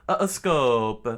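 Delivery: name the move out loud; battle shout. Acted. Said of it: "Mister Chopper screamed out".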